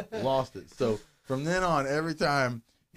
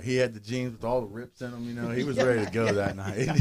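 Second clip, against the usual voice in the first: ordinary delivery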